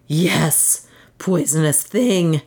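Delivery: restrained, passionate accusation; a touch of furious passion; beside himself. A narrator's reading. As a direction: hurl the insult with contained fury. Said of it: "beside himself with passion".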